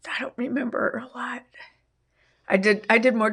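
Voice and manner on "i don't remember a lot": whisper. Real, not acted. Recalling grief, drops to whisper.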